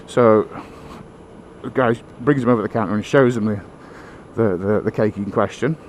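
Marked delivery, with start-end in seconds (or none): none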